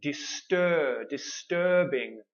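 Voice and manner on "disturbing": disturbing drawn out slowly; slow demo; slow model word. Shown as a demonstration. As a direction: stretch disturbing, er clear. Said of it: "the last one is".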